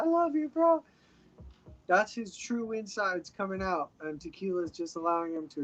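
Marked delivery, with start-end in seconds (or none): 0.0-0.8 much higher, gushing "I love you, bro"; playful impression of an emotional drunk at a family party; mock emotional drunk. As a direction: gush it like a teary tipsy uncle, half-joking. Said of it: "emotional Mexican crying and being like".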